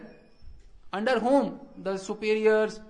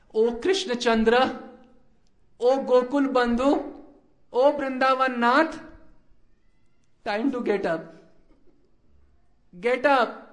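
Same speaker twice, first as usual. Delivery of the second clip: loud, higher wake-up calls; calling out; 4 semitones higher, 6 dB louder than usual. Retold or imitated. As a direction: call out to rouse him. Parrot calling Krishna awake.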